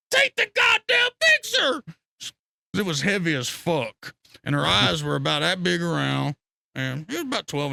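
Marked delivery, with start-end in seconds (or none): 0.1-1.8 very high, loud, slow yell; screaming. Retold or imitated. Holding a giant bass, he yells at Josh, loud high scream. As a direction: bellow it with a huge fish in hand. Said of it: "I was screaming at him like this".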